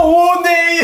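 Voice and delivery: high startled shriek into laughter. Real, not acted. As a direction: jump with fright, then laugh. Found hiding in the sauna.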